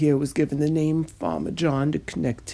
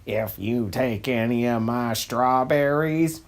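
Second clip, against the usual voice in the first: lower, menacing farmer voice; gruff farmer threat; 6 semitones lower than usual. Acted. Farmer John warns the duck off his strawberries, low and menacing. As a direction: growl the warning down low. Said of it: "Farmer John".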